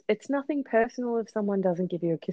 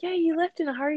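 put-on casual dig; 8 semitones higher, faster than usual. Acts own mock-casual dig.

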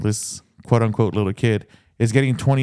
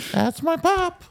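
put-on proud little boy pointing out his dad; little kid voice; slower than usual. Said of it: "the one that's playing the little kid".

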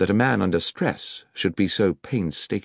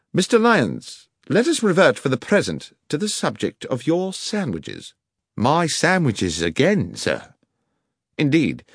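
a lightly voiced Holmes pressing the guard, higher; Holmes interrogating; 6 semitones higher than usual.

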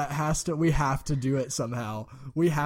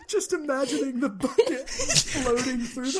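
giggling through the words; can barely speak for laughing; 12 semitones higher than usual. Real, not acted. Breaking up in laughter.

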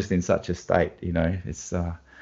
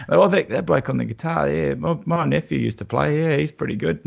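chatty wedding guest; 7 semitones higher than usual. Retold or imitated. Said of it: "your classic Australian".

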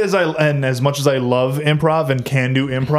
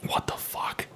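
whisper; 15 dB quieter, slower than usual. Real, not acted. Amazed whisper.

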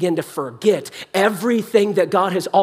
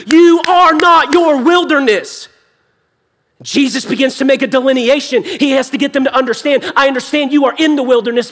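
emphatic, raised pulpit proclamation; forceful preaching; 8 semitones higher, 6 dB louder than usual. Real, not acted. Preacher tells congregation they are not their wilderness, forceful preaching. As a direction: drive the point home with fervour.

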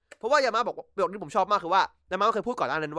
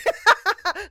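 sudden high laugh; short gleeful laugh; high burst of laughter; 14 semitones higher, 7 dB louder than usual. Real, not acted. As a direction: crack up at the joke. Laughing at his own joke.

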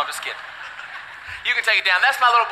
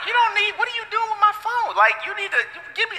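higher, flustered mock scolding; hammed-up higher scolding of a baby; flustered dad; 10 semitones higher, 4 dB quieter than usual. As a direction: play the dad losing the phone fight. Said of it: "I was like".

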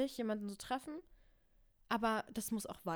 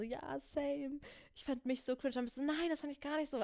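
lightly voiced date, higher and soft; higher, softer mock-dialogue voice; imagined date talk; 5 semitones higher, 4 dB quieter than usual. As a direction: play both sides of the date, pitched up. Acts out imagined date's dialogue.

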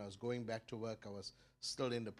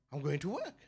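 bucky reply; 3 semitones higher, 5 dB louder than usual. Retold or imitated. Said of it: "I answer again, but this time a little bit more".

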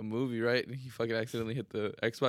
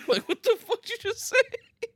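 laughing hard; 4 dB louder than usual. Real, not acted.

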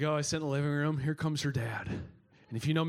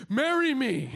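higher, louder hammed-up plea; mock movie proposal; 9 semitones higher, 5 dB louder, much slower than usual. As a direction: play it like a movie hero below the window. Imagined movie proposal under window.

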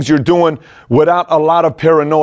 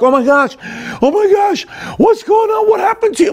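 high, shrieking mock-panic mom voice; panicked mom. Mom panicking when her son falls at the park, high shrieking. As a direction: shriek like a frantic mom over a fallen kid. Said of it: "my mom would be like".

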